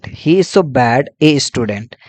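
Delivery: each word spaced out slowly for notes; measured dictation pace. Shown as a demonstration. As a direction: read it out slowly so students can copy it down. Teacher dictating an example sentence.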